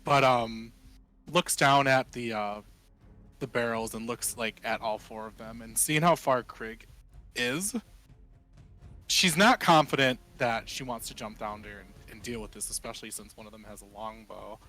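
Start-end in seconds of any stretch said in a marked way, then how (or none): none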